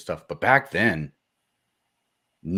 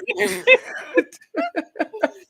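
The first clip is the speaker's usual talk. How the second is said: high laugh; 4 dB louder than usual. Real, not acted. Laughing after the bit.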